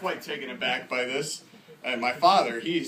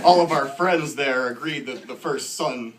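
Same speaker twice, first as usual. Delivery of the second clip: ordinary talk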